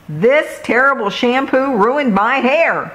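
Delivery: a hammed-up read-aloud scream; mild storybook scream. A narrator's reading. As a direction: weasel complains to the shopkeeper about her hair. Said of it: "she screamed".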